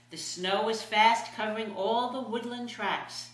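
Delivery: ordinary delivery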